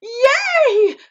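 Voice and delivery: ecstatic cheer. Retold or imitated. The narrator reliving winning the trophy, ecstatic. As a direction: cheer out in delight. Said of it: "And I was like".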